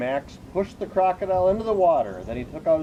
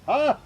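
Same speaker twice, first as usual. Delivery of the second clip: triumphant ha; 11 semitones higher, 5 dB louder than usual. Acted. Detective's triumphant exclamation.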